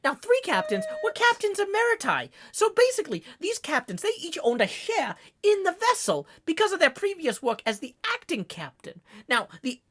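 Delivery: high, fast trivia-gushing sailor voice; excited narrator. Pretend. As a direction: gush ship facts, lightly voiced Ishmael. Rambling Ishmael trivia voice.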